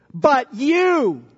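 "But you" with "you" set apart and punched; emphatic you. Shown as a demonstration. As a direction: land hard on "you". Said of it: "emphatically saying this".

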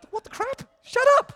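shocked outburst. Retold or imitated. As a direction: blurt it out in mock shock. Shocked reply to friend's guess.

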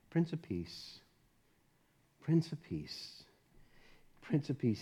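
hushed worshipful repetition; tender, reverent. Acted. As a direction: let the name settle gently. Soft repeated title.

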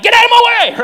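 mock road rage outburst at another car; road rage shout. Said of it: "we're driving to work and we're like".

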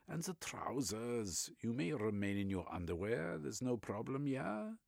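put-on Reich in a German accent, eagerly expounding.

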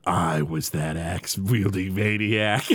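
creepy storyteller voice. Retold or imitated. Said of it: "And he's like".

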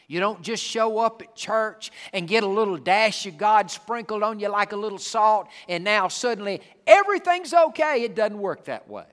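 shouting the point from the pulpit; preaching shout. Real, not acted. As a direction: build it into a full-voiced sermon shout.

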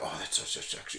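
disaffected, very soft and voiceless accent impression; Donatella Versace. Imitating a comedian's Versace, soft and disaffected. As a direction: let it out low-energy, utterly unimpressed. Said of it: "she sounds so disaffected".

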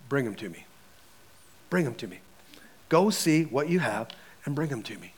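low, soft, measured instruction; hushed command. Acted. Jesus sending his disciples to check their food, hushed command. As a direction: quietly tell them, letting each phrase drop.

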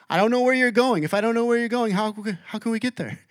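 a lightly voiced bewildered Thomas; confused Thomas. Pastor voicing Thomas confused about the destination, puzzled. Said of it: "poor confused Thomas".

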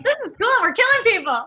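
loud, high-pitched mock glee; gleeful excitement. Host telling interviewer how she relished the plot twist, gleeful excitement. Retelling her glee at the killing.

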